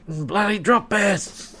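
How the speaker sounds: high, slow hammed-up Australian exclamation; exaggerated Australian accent. Joking about drop bear victims, hammed-up Australian accent. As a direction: draw it out in mock-Australian outrage.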